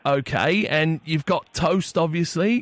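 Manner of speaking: badly faked Englishman on the phone; poor English accent. Prank caller [name] asks cafe about tea, poor English accent. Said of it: "disregard the piss poor English accent".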